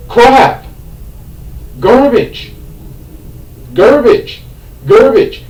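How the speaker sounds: sharp high angry cries of disgust; angry outburst. Real, not acted. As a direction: yell it like the movie insulted you. Rant about Harry's backstory.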